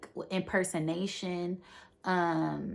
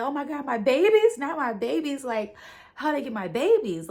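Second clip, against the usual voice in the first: high, loud mock panic; panicked wail; 8 semitones higher, 7 dB louder than usual. Robotnik learns someone took his drones, high loud panicked wail. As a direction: wail over his stolen babies.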